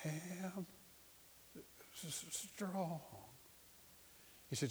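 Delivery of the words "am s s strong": halting, faint, long pauses between words; feeble, higher, breaking off mid-word; barely able. Retold or imitated. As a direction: imitate a dying woman straining out each word. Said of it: "just barely couldn't whisper".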